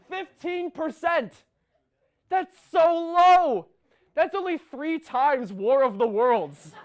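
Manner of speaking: high, loud, drawn-out disbelief; outraged comic shouting. Acted. Comedian ranting at a dreadful movie score, outraged shouting. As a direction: shout each point in mounting comic outrage.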